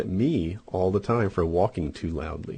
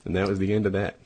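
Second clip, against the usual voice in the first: normal talking voice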